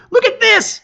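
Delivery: high, bright exclaim over a flower; excited exclaim. A narrator's reading. Lizard Sars calling his friend over, excited. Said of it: "Sars exclaims".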